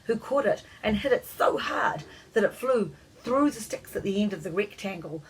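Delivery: plain reading voice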